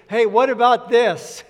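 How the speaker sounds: ambushing someone with a big question; mock challenge. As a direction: playfully pounce with a question, loudly. Acting out ambushing a pastor.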